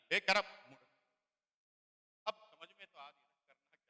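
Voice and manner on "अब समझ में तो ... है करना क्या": ordinary talk, nothing marked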